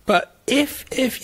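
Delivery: high, indignant interruption. Real, not acted. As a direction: protest in a high voice. Indignant protest, pitch jumps.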